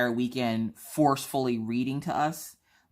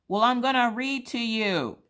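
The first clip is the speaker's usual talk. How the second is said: put-on bright child voice; 11 semitones higher than usual. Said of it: "And she goes".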